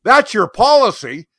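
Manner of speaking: high, loud mock outrage; hammed-up indignant shout; very loudly. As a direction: shout it back at the clerk. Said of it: "very loudly".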